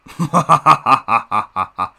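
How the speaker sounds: hammed-up laugh at own joke; smug villain chuckle. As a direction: cackle like a cartoon villain.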